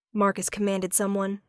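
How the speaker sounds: ordinary delivery, nothing marked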